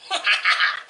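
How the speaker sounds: short low staccato chuckle; amused little laugh. Real, not acted. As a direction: chuckle to yourself in little bursts. Laughs at herself while eating.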